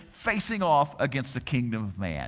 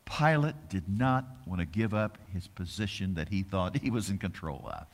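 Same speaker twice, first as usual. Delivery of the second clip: usual speaking voice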